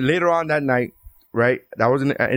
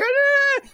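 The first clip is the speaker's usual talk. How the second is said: very high mock wail; exaggerated, high-pitched crying noise; imitated crying wail. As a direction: imitate a girlfriend bawling in a high squeal. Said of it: "she's crying, bro, going like".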